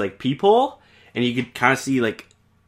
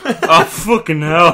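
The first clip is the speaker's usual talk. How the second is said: slow, higher, louder laughing curse; 5 semitones higher, 7 dB louder, slower than usual. Real, not acted. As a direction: laugh out the curse after biting your tongue. Bit tongue, laughing.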